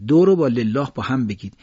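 ordinary talk

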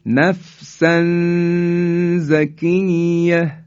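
slow chanted recitation of نفسا زکیه; Quran recitation. Shown as a demonstration. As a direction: recite نفسا زکیه slowly with ikhfa nasal. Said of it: "مثال بعدی".

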